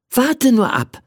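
high, assured reply; confident. Acted. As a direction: promise it with cheerful certainty. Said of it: "erwiderte ich zuversichtlich".

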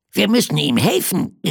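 high plush-crocodile voice; little crocodile calling. Acted. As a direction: cry out as an eager little toy creature. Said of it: "rief es". (hohe Krokodilstimme, rufend)

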